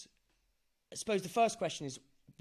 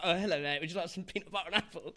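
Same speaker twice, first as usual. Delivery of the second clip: mock posh London accent, lightly put on and a bit quicker; posh Londoner; 3 semitones higher, 3 dB louder, faster than usual. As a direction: playfully imitate an overly polite posh Londoner offering samples. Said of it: "I was like some posh Londoner".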